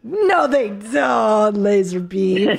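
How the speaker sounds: drawn-out, astonished denial; very slow, savouring the absurdity; slow disbelief. Real, not acted. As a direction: stretch each word in disbelief. Disbelief at the slang term.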